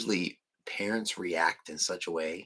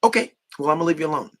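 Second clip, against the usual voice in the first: high, shrugging brush-off; breezy high "fine then"; dismissive; 8 semitones higher, 6 dB louder, faster than usual. Retold or imitated. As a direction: toss it off and walk away. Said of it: "I'm not gonna sit there and kiss your ass".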